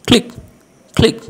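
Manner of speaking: ক্লিক said as klik, short i; ক্লিক with k and l joined, klik; click pronounced. Shown as a demonstration. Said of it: "উচ্চারণটা করব ক্লিক".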